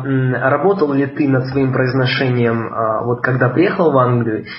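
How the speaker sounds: ordinary talk, nothing marked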